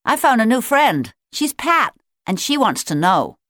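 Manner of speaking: put-on excited child. A narrator's reading. Bobby excitedly tells his mother.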